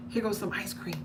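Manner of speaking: put-on hushed secret whisper to the kids; breathless whisper, keeping it secret from mommy; conspiratorial whisper. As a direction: whisper it like a sneaky bribe behind mom's back. Parent sneaking kids treats, secret.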